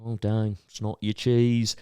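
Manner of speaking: drawn-out put-on accent; deadpan showman in a put-on accent; Cheese Man accent. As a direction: lightly put on the performer's accent. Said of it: "I gotta do his accent".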